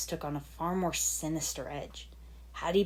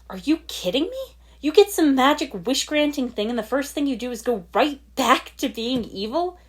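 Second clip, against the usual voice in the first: much higher and louder, angry shout; furious snapping; 8 semitones higher, 8 dB louder than usual. Acted. Lloyd struggling against his friends, angry shout. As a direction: let the outrage explode. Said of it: "he snapped for a third time".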